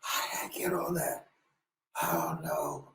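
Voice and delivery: breathy aside, barely voiced; whisper. Real, not acted. Speaker confides to the audience, hushed whisper. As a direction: lean in and whisper it.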